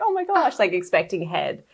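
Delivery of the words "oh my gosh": a high, loud startled oh my gosh; surprised exclaim. Retold or imitated. Everyone at the birth, startled by the baby, exclaims. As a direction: blurt it out as the baby suddenly arrives. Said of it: "everyone's like".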